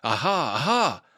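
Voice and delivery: practicing aha. Acted. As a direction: build the aha up to a shout.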